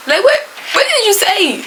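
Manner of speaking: high-pitched, puzzled "what?"; mock bewildered questioning. Questioning the meowing cat.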